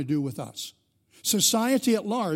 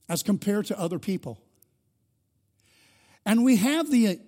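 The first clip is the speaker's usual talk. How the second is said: raised, rising preacher's voice; preaching at full force; 4 semitones higher, 4 dB louder than usual. Acted. Pastor stirring the congregation, preaching hard. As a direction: build the sermon up, pressing harder.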